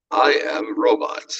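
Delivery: slow, loud robot voice; slow mechanical robot delivery. Shown as a demonstration. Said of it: "why do I do that with my voice".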